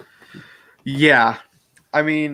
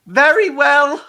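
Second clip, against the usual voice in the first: much higher, louder mock-grand voice; hammed-up high voice; 14 semitones higher, 6 dB louder, slower than usual. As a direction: deliver it like a pompous hero. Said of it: "imitating a Doctor line".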